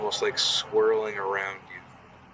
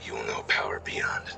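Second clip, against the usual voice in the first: dark whisper behind you; 12 semitones lower, 4 dB quieter than usual. Acted. GM voices shadow entity.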